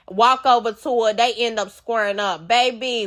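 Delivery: normal talking voice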